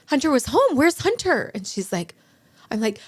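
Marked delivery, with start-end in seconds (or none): 0.1-0.8 high, loud frantic cry; retold panic, raised and loud; panicked cry. Panicked in the car, asking for son. 0.8-1.5 frantic. Retold or imitated. Panicked in the car, asking for son.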